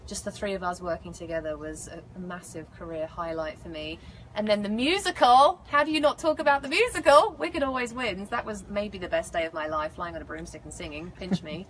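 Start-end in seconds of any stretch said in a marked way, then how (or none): 4.3-8.3 high, loud burst of enthusiasm; excited gushing. Real, not acted. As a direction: exclaim it, pitch and volume leaping up. Gushing over her favourite episode.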